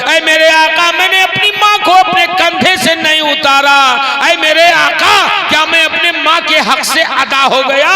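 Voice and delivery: high, loud, half-chanted declamation; full-force preaching. Acted. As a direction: recite the story fervently, drawing out the cries. (बुलंद, लंबी खिंची जोशीली तकरीर)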